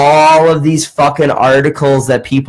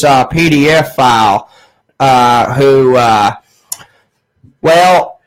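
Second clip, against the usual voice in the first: hammed-up Southern caller; Southern accent; 2 semitones higher than usual. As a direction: imitate an older Southern caller, raised voice. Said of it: "he had a southern accent".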